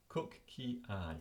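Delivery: "Cook key eye" slowly, as three separate English words; mnemonic sound-out. Shown as a demonstration. Teacher explaining a memory hook for spoon, slow sound-out. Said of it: "a mnemonic, something I can remember".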